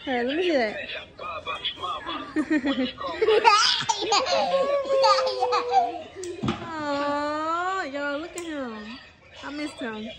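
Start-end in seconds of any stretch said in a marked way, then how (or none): 0.8-6.5 high laughter. Real, not acted. Laughs at the toy.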